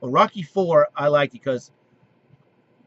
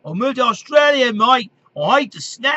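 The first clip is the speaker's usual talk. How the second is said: mock Australian voice; 10 semitones higher, 8 dB louder than usual. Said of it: "something like that".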